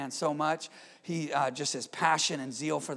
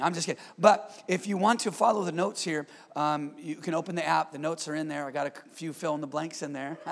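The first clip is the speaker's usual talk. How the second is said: usual speaking voice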